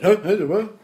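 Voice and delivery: confused half-asleep mumble; groggy just-woken friend. Retold or imitated. Imitating a friend woken by hard braking, groggy confusion. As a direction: play the friend jolted awake by braking. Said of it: "that sounded just like him".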